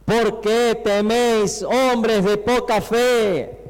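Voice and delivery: slow, projected scripture quote; grand, drawn-out reproach; solemn rebuke. Acted. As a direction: declaim it slowly, stretching each phrase. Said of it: "Y él les dijo".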